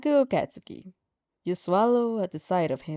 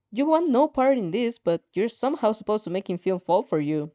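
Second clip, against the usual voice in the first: ordinary delivery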